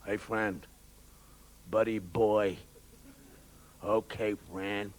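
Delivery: very low, slow American threat; menacing American. Acted. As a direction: warn him in a deep American voice. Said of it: "Americans are always".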